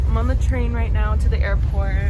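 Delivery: loud voice over train noise; shouting on a subway. Real, not acted.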